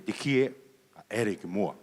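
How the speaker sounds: ordinary talk, nothing marked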